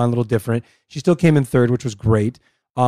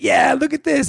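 high-pitched hyped-up fan; pitched way up, caught up in it; excited cheering; 13 semitones higher, slower than usual. Retold or imitated. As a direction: re-enact getting carried away by the fight. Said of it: "we both got so into it".

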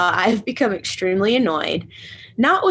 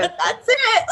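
very high mock confirmation; forced nurse cheer; 11 semitones higher than usual. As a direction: chirp the obligatory answer high. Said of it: "I'm gonna have to go".

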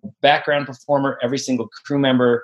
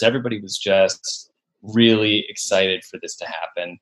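ordinary talk

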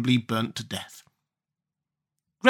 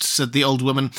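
ordinary delivery

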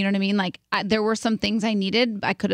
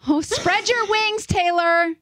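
high, slow, hammed-up sob to the sky; tearful mock farewell, pitched high; mock tearful cry; 10 semitones higher, slower than usual. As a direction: cry out like a proud mother bird. Mock-tearful tribute to Taylor.

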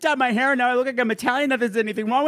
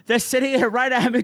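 ordinary talk

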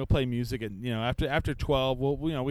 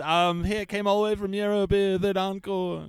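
mock old cowboy; 5 semitones higher, 3 dB louder than usual. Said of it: "He's all".

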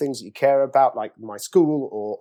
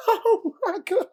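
high, slow, laughing astonishment; stunned, giggling disbelief at the table; awestruck oh my God; slower than usual. Retold or imitated. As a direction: gasp it out like you're starstruck. Seeing the famous cast.

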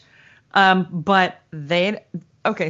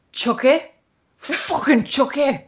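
put-on higher, drawn-out tough kid voice; little girl; 5 semitones higher, slower than usual. Little girl naming who bit her, indignant. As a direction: stretch it like an indignant small child. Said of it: "the little girl goes".

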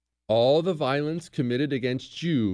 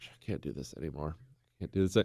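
mock despair; 8 semitones lower, 9 dB quieter than usual. Giving up on teaching Chris.

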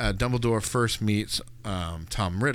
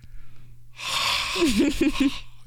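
squeaky rubber duck. Retold or imitated. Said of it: "she's like".

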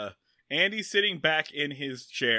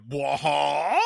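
mock baffled laugh; 3 dB louder than usual. Mimicking the villain's stunned reaction, a baffled laugh.

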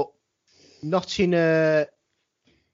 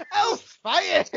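1 excited; 13 semitones higher than usual. Real, not acted. Laughing exclamation.

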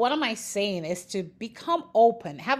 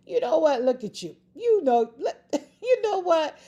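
higher, amused taunt breaking into laughter; laughing at it; 6 semitones higher, 3 dB louder than usual. Retold or imitated. Speaker mocks her hard situation, laughing at it. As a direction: laugh right in the trouble's face. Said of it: "I'm laughing at, like".

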